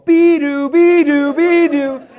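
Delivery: loud, high minion siren; child's siren imitation. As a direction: blare it like an excited kid. Acts son imitating police siren.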